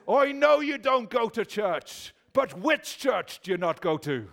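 put-on a tough's accent that slips; a man on the Shankill Road pressing a stranger, in a fading Irish accent; mock Irish accent. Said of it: "That wasn't even an Irish accent".

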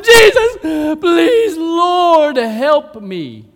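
high, shouted cry, easing at the end; acted wailing appeal; desperate pleading. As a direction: throw yourself at someone's mercy. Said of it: "threw herself before him".

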